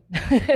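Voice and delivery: a burst of high laughter; loud rising laugh. Real, not acted. Laughing loudly after admitting she failed the alcoholism checklist. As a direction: laugh out at your own failed test.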